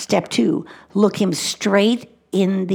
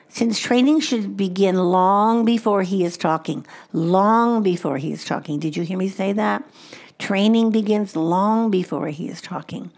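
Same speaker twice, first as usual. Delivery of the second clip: ordinary talk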